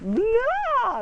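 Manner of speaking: high, loud jump-scare yell; scare shout. Retold or imitated. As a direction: leap out to scare them. Pranksters jump out scaring.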